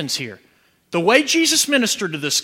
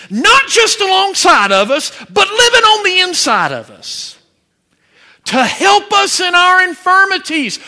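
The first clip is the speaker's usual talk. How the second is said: full-force preaching; 9 semitones higher, 9 dB louder than usual. Real, not acted. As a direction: preach it to the whole room at full voice.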